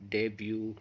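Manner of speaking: "डेब्यू" said softly as the answer; soft, low "डेब्यू" to close the question; quiet model answer. Shown as a demonstration. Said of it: "बल्कि क्या कहेंगे?". (धीमे स्वर में सही उत्तर "डेब्यू")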